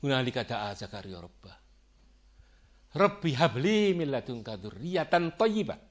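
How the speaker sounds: low, measured Arabic recitation; Quran recitation. Acted. Preacher reciting Zakariya's prayer to the congregation, devout.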